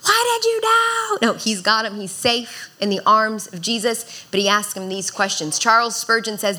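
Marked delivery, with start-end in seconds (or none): 0.0-1.2 mock frantic yell. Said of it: "wasn't trying to have this conversation when he's sinking".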